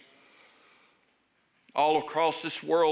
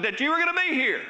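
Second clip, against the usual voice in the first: words breaking into a real laugh, higher and faster; 10 semitones higher, 3 dB louder, faster than usual. Real, not acted. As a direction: laugh through the line at the coincidence. Preacher laughs at himself.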